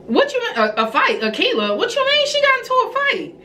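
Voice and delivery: high-pitched incredulous retold outburst; shocked disbelief. Mother reacting to news of her daughter's school fight, incredulous. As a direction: demand an explanation, voice shooting way up.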